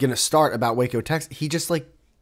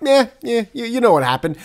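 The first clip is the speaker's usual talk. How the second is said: dismissive mock; 9 semitones higher, 6 dB louder than usual. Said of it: "you're gonna just be like".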